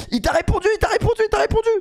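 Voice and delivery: high, squealing excited repetition; excited fans. Retold or imitated. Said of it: "je me fais spam sur Twitter".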